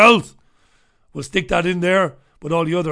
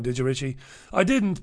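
usual speaking voice

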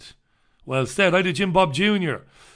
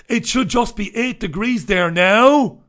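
mock alarm; 5 semitones higher, 6 dB louder than usual. Mock-dramatic reading of newspaper story.

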